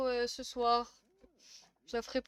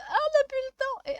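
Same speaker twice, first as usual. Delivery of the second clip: panicked; 12 semitones higher than usual. Retold or imitated. Acts out end-of-life panic.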